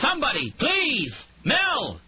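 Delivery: high, loud cry for help; desperate shout. A narrator's reading. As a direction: yell through the closed door. Paralyzed man calling for help.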